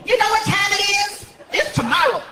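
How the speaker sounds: high sassy woman voice; sassy clerk lady. Retold or imitated. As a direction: talk down like a fed-up clerk.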